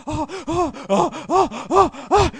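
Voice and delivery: high, rhythmic mock moans of ecstasy; mock orgasmic moaning. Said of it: "orgasming, man".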